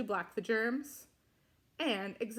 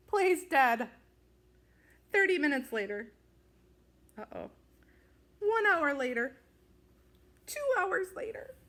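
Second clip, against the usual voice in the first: louder, emphatic time announcements; dramatic countdown; 5 semitones higher, 5 dB louder than usual. A narrator's reading. Narrator counting the hours Scaredy plays dead, in mock suspense.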